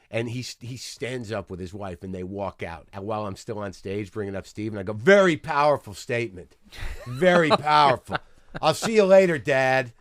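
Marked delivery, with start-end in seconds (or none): 4.9-8.2 loud mock praise, pushed out; retold sarcastic call-out. Comic jeering from stage at departing couple, loud sarcasm. 8.6-9.9 loud, jeering goodbye; retold sarcastic farewell. Comic calling after the old man leaving, loud mock farewell. Retold parting shot from stage.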